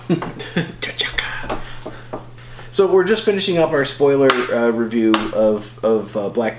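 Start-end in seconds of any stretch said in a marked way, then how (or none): 0.1-1.6 breathy stage whisper; hammed-up whisper. Host finishing the impression, mock whisper. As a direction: whisper the name as a punchline.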